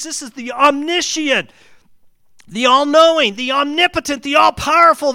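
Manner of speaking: loud, high, shouting sermon climax; preaching at full force. Real, not acted. Preacher exalting God to the congregation, shouting. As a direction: build the praise of God to a roar.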